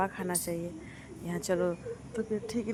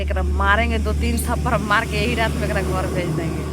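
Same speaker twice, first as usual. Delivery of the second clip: loud, furious retold threat of slaps; angry threat; 9 dB louder than usual. Sister fed up at midnight threatens to slap her, furious. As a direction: blow up at the troublesome cousin. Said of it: "वो गुस्सा में होकर के करेगी".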